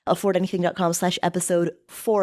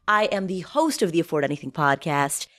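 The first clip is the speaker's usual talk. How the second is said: normal talking voice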